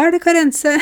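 high, excited question of recognition; a thrilled tourist spotting her, pitched high; surprised recognition. Retold or imitated.

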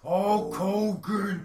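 loud, high, drawn-out wrestling bellow; Warrior. Retold or imitated. Host imitating the wrestler's repetitive promo, loud drawn-out bellow. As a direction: stretch the name into a booming promo roar. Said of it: "him just saying Hulk Hogan".

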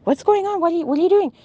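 high-pitched, agitated retold questions; alarmed questioning. Niece or nephew questions uncle grabbing the snake, in high alarm.

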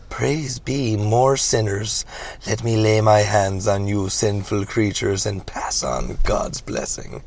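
slow, drawn-out mock sermon; preacher reverend, sanctimonious. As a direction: welcome the sinners with oily piety.

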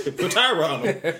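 a hint of a reverend preaching it; mock preacher. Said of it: "Reverend Harris".

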